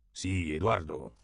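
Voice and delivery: "Eduardo" spelled out slowly syllable by syllable, falling; over-articulate "Eduardo" with dry patience; articulated slowly. A narrator's reading. Pépé humoring the missionary, slow articulated "Eduardo". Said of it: "articula Pépé".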